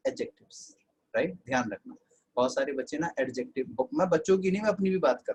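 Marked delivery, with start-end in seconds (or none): 0.1-0.8 whispered try-out. Shown as a demonstration. Teacher softly testing the word for students, whispered. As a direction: whisper adjectives to yourself. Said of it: "अपने आप से बोल के देखो".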